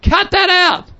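high-pitched yell without full volume; retold yell. Missionary in his tent to his growling dog, retold yell. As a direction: act out yelling at a misbehaving dog. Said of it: "and I yell".

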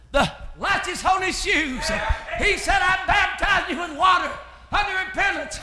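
loud, driving revival preaching; fervent preaching. Acted. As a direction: preach it hard, line by line. Preaches at full force.

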